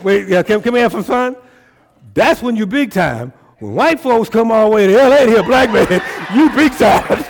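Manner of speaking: loud high crowd-rousing peak; laughing while preaching it loud; excited preaching. Real, not acted. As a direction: shout it up to the room.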